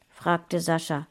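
usual speaking voice

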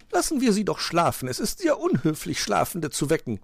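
high-voiced lady magpie; objecting magpie. A narrator's reading. Magpie says let Frau Holle sleep, objecting. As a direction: plead primly in a high voice. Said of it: "meinte Frau Elster".

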